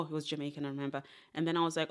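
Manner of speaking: ordinary talk, nothing marked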